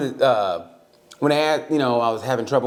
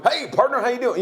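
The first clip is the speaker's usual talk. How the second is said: much higher, folksy greeting; mock good ol' boy; 10 semitones higher than usual. Politician greeting a visitor, high and folksy. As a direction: greet like a friendly country politician. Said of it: "you walk to him".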